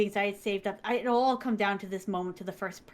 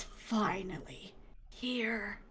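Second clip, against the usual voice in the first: slow, hushed whisper; 9 dB quieter, much slower than usual. Retold or imitated. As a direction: breathe it in awe. Whispered, then loud.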